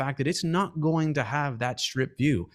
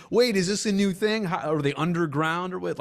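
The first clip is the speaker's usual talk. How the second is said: higher, mildly alarmed imitation of baffled people; mock freaked-out; 5 semitones higher than usual. Host mimicking people reacting to stadium renderings, mock freaked-out. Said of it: "people freaked out".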